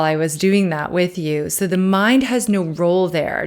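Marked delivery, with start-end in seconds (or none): none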